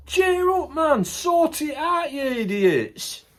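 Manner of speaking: high, loud, slowing on the insult; shouting at leaders. Acted. Vlogger yelling at world leaders to sort it out. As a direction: bellow it at the idiots in charge.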